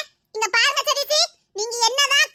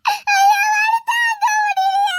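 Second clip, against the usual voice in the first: slow, higher drawn-out moan; hammed-up pain wail; 5 semitones higher, 3 dB louder, much slower than usual. Wife faking her injury, slow louder wail. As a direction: overplay the hurt, slowly.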